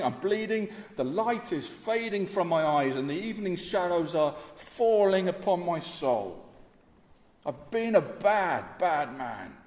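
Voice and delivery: loud, high, desperate pleading of a dying man; dying thief's plea. Acted. Preacher voicing the dying thief, crying out for mercy. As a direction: beg Jesus for mercy with your last strength.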